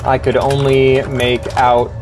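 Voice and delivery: slow, measured reading of the game's text; slow story reading. A narrator's reading. Streamer reading the game's story aloud, slow and measured. Streamer reads game narration.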